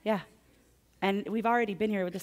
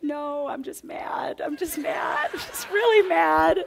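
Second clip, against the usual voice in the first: half-laughing, half-crying, much higher and building louder; laughing-crying mad; 10 semitones higher, 9 dB louder, slower than usual. Retold or imitated. To the gardener after falling on the pavement, laughing through tears. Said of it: "I'm like".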